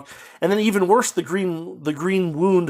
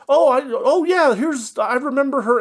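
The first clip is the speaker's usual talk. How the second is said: mock-excited remembering, pitched way up; sudden mock recollection; 10 semitones higher than usual. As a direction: parody a feeling switching on at once. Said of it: "flips on and off like a switch".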